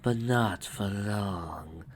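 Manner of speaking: very slow, hushed threat; slow, soft villain drawl; menacing villainess. A narrator's reading. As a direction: draw it out ominously.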